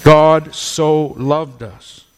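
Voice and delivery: slow, weighted "God so loved us"; slow emphasis. Shown as a demonstration. Preacher driving home the point, slow and weighty. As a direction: lean on "God", then let each word fall slowly. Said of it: "That's the emphasis being made here".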